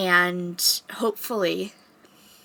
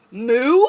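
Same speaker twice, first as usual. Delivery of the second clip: loud playful moo; cow moo; 3 semitones higher, 9 dB louder than usual. Pretend. Librarian making cow noise for kids, loud playful moo.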